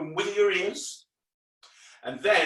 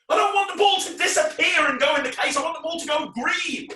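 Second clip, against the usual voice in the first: high, loud comic whine of protest; hammed-up exasperated outburst; mock tantrum; 11 semitones higher, 5 dB louder than usual. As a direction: protest at full pitch, easing at the end. Comic tantrum over the failed trick.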